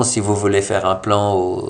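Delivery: usual speaking voice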